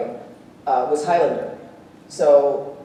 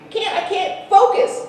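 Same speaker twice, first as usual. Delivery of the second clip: mock complaint; 3 dB louder, slower than usual. Real, not acted. Complaining to chatty audience.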